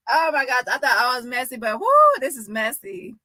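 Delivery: ordinary talk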